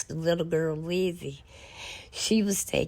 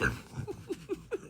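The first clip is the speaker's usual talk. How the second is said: laugh; 10 dB quieter than usual. Real, not acted. Laughing at the memory.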